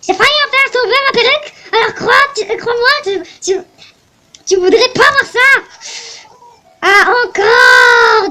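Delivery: put-on loud, high cracking old lady voice; old lady complaining about tourists; shrill old lady. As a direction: squawk like an outraged granny. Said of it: "Je vais lui faire un bon accent".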